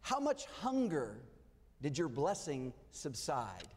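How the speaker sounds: slow, weighty challenge to the pews; slow rhetorical question. Acted. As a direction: let each word sink in. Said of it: "Let me ask this question".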